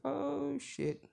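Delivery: sudden squeaky high yelp; startled Igno. Retold or imitated. Igno spots Makarov coming out, yelps high. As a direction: jump way up in pitch, caught off guard. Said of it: "and Igno says".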